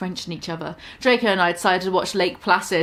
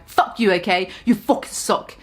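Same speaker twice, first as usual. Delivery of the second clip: ordinary delivery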